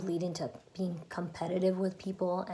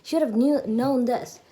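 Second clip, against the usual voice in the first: rising self-scolding, louder and higher by the end; scolding yell at oneself; 4 semitones higher, 6 dB louder than usual. Acted. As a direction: build into an exasperated telling-off of yourself. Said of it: "yelling at ourselves like".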